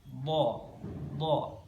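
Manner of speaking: "Do" as heavy Arabic dhad; thick lateral d in "Do"; tongue side on molars. Shown as a demonstration. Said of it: "menempel ke gigi geraham".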